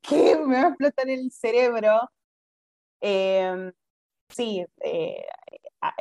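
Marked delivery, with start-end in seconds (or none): 0.0-2.1 high, loud, mock panic; mock overwhelmed. Recalling Austria, overwhelmed by languages, mock panic. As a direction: act out your brain about to explode. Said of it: "literalmente fue como".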